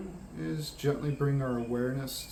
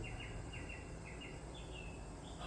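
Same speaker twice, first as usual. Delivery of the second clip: slow, airy exhale; long relieved sigh; 19 dB quieter than usual. Acted. Meditation leader settling into the resting spot, long sigh. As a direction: let out a long settling breath.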